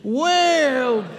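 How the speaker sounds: high, drawn-out WHERE; sarcastic victory taunt. Shown as a demonstration. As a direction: launch the taunt high, then let it fall. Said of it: "sarcasm in there".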